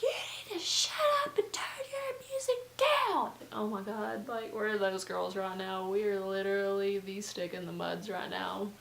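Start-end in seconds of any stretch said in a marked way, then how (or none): 0.0-3.3 high, piercing angry yell. Retold or imitated. Roommate ordering partiers to turn music down, yelled high. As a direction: holler down at the neighbors at full pitch. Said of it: "That's why she's yelling it".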